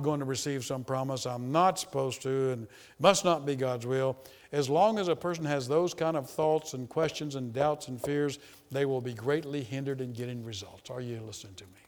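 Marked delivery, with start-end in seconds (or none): none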